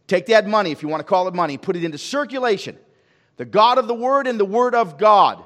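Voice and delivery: loud, driving sermon cadence; preaching at full force. Acted. Pastor pressing the church on spiritual capital, preaching loudly. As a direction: preach it hard to the congregation.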